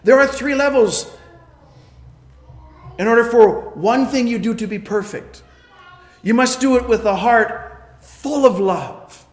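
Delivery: loud preaching with every must hammered; ringing sermon delivery; full-force preaching. Acted. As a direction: drive each point home from the pulpit. Preacher drives points home loudly.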